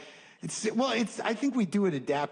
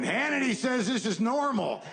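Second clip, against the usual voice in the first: dumb bystander voice; 8 semitones higher than usual. Retold or imitated. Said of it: "Some other dude in the back going".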